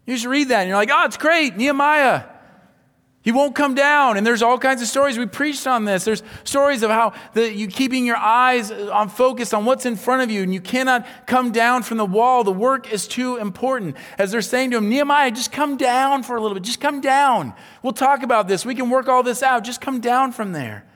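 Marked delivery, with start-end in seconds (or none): 0.9-4.2 high, loud burst of mock delight; pitched way up, playing impressed; acted enthusiasm. Acts out an enthusiastic reader. 14.9-20.8 high, loud pleading call; insistent, pitched-up mock persuasion; acted coaxing. Acts enemies coaxing Nehemiah down.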